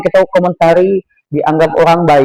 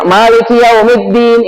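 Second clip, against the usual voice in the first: "Maliki yaumiddin" pushed out higher and quicker, without the swing; "Maliki yaumiddin" in a raised, hurried recitation; not gentle; 4 semitones higher, slower than usual. Shown as a demonstration. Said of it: "itu nggak tepat, harusnya dibaca lembut".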